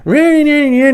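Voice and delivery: high, brash mock Northerner, laughing; squeaky send-up of a loud Northerner; mock loud Yankee. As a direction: ham up a loud Yankee tourist. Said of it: "They always talk really loud".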